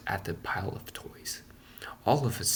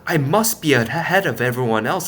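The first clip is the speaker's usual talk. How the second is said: higher, louder self-assured thinking; Punchinello's thoughts; 5 semitones higher, 7 dB louder than usual. A narrator's reading. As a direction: think it proudly to himself. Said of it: "he thought to himself".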